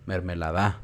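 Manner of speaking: "mermelada" stressed on the final syllable DA; misplaced stress. Shown as a demonstration. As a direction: push the last A of "mermelada", as if mermeladá. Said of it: "si le ponen más fuerza en otra sílaba". ("mermelada" con la fuerza en DA)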